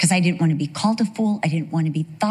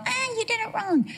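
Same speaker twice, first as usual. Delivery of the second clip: high, softer mock scold; 6 dB quieter than usual. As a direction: toss off a petty scolding. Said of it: "It's not just to punish and go".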